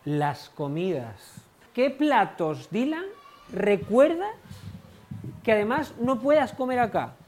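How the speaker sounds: usual speaking voice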